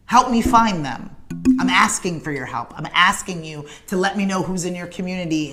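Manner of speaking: ordinary delivery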